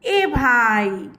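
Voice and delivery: a mild call to a passing vendor, drawn out. Retold or imitated. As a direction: call the balloon seller over. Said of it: "उसको हम कैसे बुलाते हैं?".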